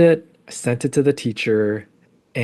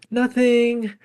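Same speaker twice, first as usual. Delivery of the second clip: put-on much higher, offhand one-word kid reply; imitating her son; 13 semitones higher than usual. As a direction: toss it off like a shrugging boy. Mother retells son's answer.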